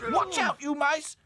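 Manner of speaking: high, loud warning call; crying out. Acted. Dotty warning the mice loudly. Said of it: "cried Dotty".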